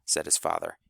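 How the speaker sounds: plain reading voice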